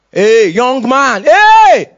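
calling out, building to a high loud yell; drawn-out warning shout. Acted. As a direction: holler after someone to stop them. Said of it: "Heaven is shouting".

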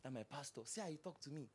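whisper-gossip about the pastor; a hushed imitation of a gossiping member; mock gossip.

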